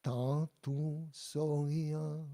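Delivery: very slow teaching demo of Tantu soya; slow model phrase. Shown as a demonstration. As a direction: stretch Tantu soya out for the class to echo. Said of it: "And the second half of the line".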